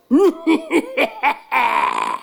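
high, drawn-out giant cackle; mocking villain laugh. A narrator's reading. Giant laughing off the prince's dream, scornful cackle.

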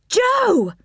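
high-pitched urgent call; crying out. A narrator's reading. Rick calls out to Joe at the controls, crying out. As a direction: call out the name, high and drawn out. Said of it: "cried Rick".

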